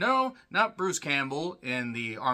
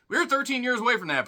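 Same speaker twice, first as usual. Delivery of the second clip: higher, louder, fast; excited; 9 semitones higher, 5 dB louder, faster than usual. Shown as a demonstration. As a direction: rush it out, thrilled. Repeated line, excited take.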